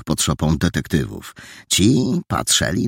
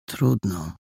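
soft, resigned sigh of a word; hushed resignation; resigned whisper; 2 semitones higher, 5 dB quieter than usual. Acted. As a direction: give in quietly. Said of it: "szepnął z rezygnacją".

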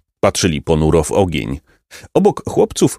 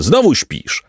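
higher-pitched scolding; mild angry yell; 7 semitones higher, slower than usual. A narrator's reading. Captain scolds Kartofel for sleeping, mild yell. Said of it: "wrzasnął kapitan".

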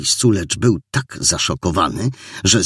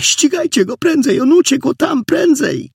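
very high, urgent cry; frantic, chaotic speech; 3 dB louder than usual. A narrator's reading. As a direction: urge them on in agitation. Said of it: "zaczęła mówić chaotycznie". (chaotyczne, wysokie ponaglanie)

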